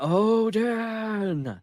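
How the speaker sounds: Odin sung out high, like a call into the fog; mock-call Odin to get an echo back. Bolvi at the bow calls Odin into the fog, a mock call. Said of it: "he's calling out".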